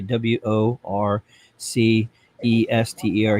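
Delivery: W O R C E S T E R spelled slowly, letter by letter; dad spelling it. Retold or imitated. Dad spelling out the town for the locals. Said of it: "My dad would say".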